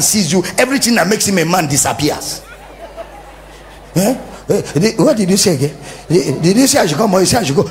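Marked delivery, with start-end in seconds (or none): 4.0-7.7 flustered husband. Retold or imitated. Cowed husband fumbling.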